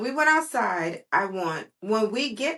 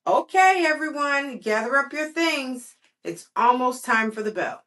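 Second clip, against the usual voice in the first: put-on higher, louder classroom call to the kids; teacher calling out; 7 semitones higher, 4 dB louder than usual. The teacher calls her class to pack up, loud and high. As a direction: round the children up like a cheerful teacher.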